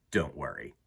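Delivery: plain reading voice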